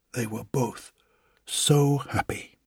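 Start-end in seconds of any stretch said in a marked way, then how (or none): none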